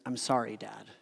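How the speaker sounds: a son quietly apologizing to his dad, sadly; son's sad apology. Retold or imitated. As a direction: let it sink like a boy who feels bad. Son slumps, apologizes in car.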